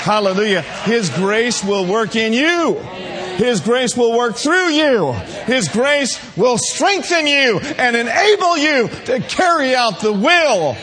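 loud, rising shouted preaching; preaching at full force. Acted. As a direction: build it up and shout it to the church.

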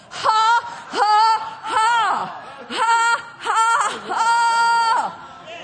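spaced, shouted ha-ha's; defiant mock laugh. Preacher laughing down at the devil, defiant mock laugh. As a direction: throw each laugh at the devil. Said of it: "and I'm gonna laugh".

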